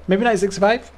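a bright unsure second guess. Real, not acted. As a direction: lift nineteen up high.

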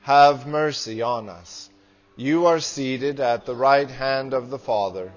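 usual speaking voice

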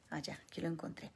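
unvoiced whisper to herself; whispered aside. Real, not acted. Preacher finding her notes, whispered aside. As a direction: mutter it aside while searching notes. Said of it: "lo estaba buscando".

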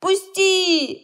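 high loud yelp; shout. A narrator's reading. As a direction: yell to be let go. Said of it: "закричал Женя". (громкий крик)

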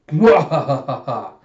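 loud, slow mwahaha cackle; hammed-up villain cackle; villain's evil laugh. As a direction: gloat in a big, drawn-out stage laugh. Villain Orochi's laugh over his map.

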